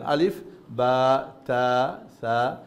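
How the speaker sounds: slow recitation of "আলিফ, বা, তা, সা"; Quranic letter names. Shown as a demonstration. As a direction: spell out "আলিফ, বা" slowly. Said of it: "শুনেন". (ধীরে কোরআনি উচ্চারণে "আলিফ, বা, তা")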